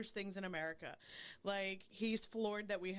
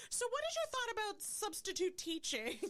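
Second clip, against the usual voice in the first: much higher put-on lady voice; imitated video lady; 13 semitones higher than usual. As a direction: pitch it way up as a mock casual question.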